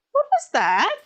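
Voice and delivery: high innocent little-troll voice; Gohan. Acted. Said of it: "Gohan being Gohan and being kind of a little troll".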